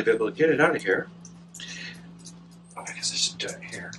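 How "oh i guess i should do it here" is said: normal talking voice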